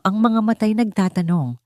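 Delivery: plain reading voice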